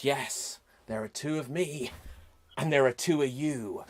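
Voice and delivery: low, soft villain voice; a sinister double, calm and low; quiet, menacing evil twin; ominous twin. Acted.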